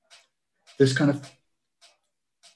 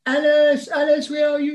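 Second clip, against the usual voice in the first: bad connection; 14 semitones higher than usual. Real, not acted. Call frozen, calling to guest.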